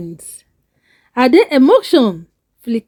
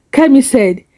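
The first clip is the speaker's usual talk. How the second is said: ordinary delivery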